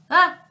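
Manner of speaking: short sharp "Ah!" try; startled gasp. Shown as a demonstration. Reader trying out the startled sound. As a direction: test a startled yelp. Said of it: "more of a startled sound, like".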